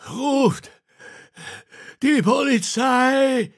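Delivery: high, strained, drawn-out gasping cry; exhausted. Acted. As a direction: gasp it out with the last of your breath. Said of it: "keuchte er erschöpft".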